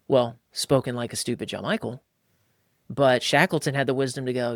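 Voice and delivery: ordinary talk, nothing marked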